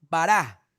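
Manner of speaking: "Bará", clean final A, stressed and falling; Hebrew bará. Shown as a demonstration. Preacher giving the right reading to listeners, stress on the last A. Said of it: "con tilde en la última A".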